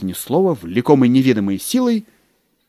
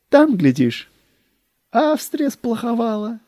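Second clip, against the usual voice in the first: high, cozy, lulling clerk; fawning secretary voice; 9 semitones higher than usual. Acted. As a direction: tempt him toward bed.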